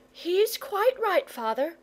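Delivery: sensible mother. A narrator's reading. Mother siding with the boy to father, sensible mother. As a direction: agree firmly in a high mother's voice. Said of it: "said the sensible mother".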